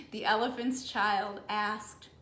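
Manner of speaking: plain reading voice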